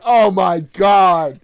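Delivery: loud, slow, disgusted "Oh my God"; a teacher's loud, drawn-out disgust; disgusted exclamation. Retold or imitated. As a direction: groan it out, appalled. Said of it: "was like".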